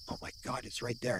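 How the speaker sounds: hushed, breathless whisper of amazement; awed whisper. Retold or imitated. Spotting the glowing light by the bridge, awed whisper. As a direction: whisper it like you can't believe your eyes. First seeing the light up close.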